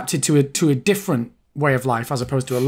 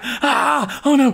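alarmed oh no; 9 semitones higher, much slower than usual. Retold or imitated. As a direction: caught out, sudden panic. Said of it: "he's like".

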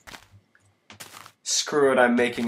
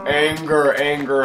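louder, hammed-up angry growl of a word; a little mock rage, louder; mock anger; 3 semitones higher, 6 dB louder than usual. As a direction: play at being furious, half in jest. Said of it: "It's angry time".

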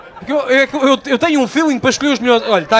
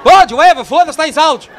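louder, high excited outburst; loud aside; 5 semitones higher, 7 dB louder than usual. Real, not acted. Comedian reacting to Daniel's wife arriving, loud. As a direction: blurt it out loud to the crowd.

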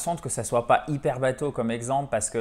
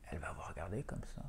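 very soft, quick whisper; whispered aside; 7 semitones lower, 21 dB quieter, much faster than usual. Acted. Teacher on the baffled listener's reaction, whispered. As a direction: drop to a hushed, hurried whisper.

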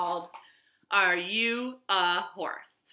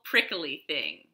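ordinary delivery

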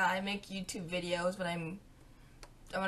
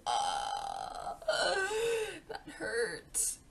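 whimper of pain; 7 semitones higher, 4 dB quieter, much slower than usual. Retold or imitated. Retelling the pain.

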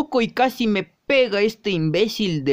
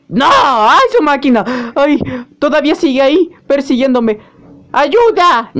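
high, loud cry for help; panicked shouting; 5 semitones higher, 7 dB louder than usual. Acted. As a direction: shriek as the chase resumes. Chased, crying for help.

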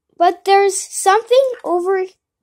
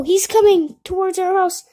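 ordinary delivery

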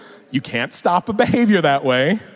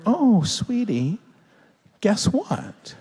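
slow, drawn-out, higher-pitched sweetness; tender parent; 7 semitones higher, much slower than usual. Retold or imitated. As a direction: coo it gently to a child. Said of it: "And I'm like".

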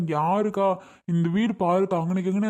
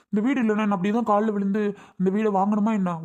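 ordinary delivery

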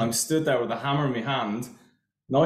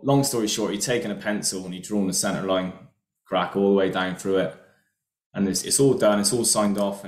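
ordinary talk

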